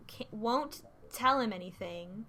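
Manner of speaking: ordinary talk, nothing marked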